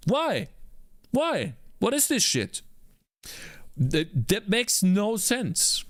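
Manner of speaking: high-pitched baffled complaining; exasperated outburst. Real, not acted. Streamer baffled by window layout logic, exasperated. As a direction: throw up your hands at the code. Code behaves illogically.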